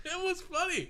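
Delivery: high laugh into words; giggling through the words. Real, not acted. Laughing at friend jumping.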